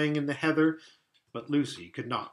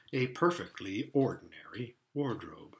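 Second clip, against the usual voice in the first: hushed; 4 semitones lower, 6 dB quieter than usual. A narrator's reading. Narration, disappointed reveal.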